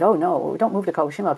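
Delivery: ordinary talk, nothing marked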